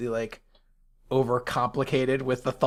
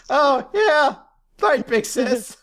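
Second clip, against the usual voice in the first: high, hasty agreeing voice; caught-on boy voice; 9 semitones higher, slower than usual. Retold or imitated. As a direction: suddenly play along. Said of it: "He's like".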